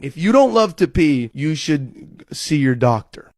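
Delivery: normal talking voice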